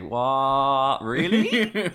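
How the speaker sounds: high, loud incredulous "What? Really?"; mock disbelief. Viewer scoffs in disbelief at the cheap set. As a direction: act scandalised by the cheap set. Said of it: "It's like".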